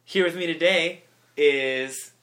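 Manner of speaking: loud, drawn-out guest introduction; announcer introduction. Pretend. Host introducing his guests, loud slow announcing.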